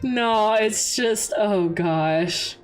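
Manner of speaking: ordinary delivery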